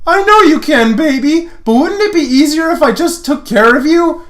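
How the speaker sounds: flirty guy voice. Acted. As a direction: ham up a cocky flirt.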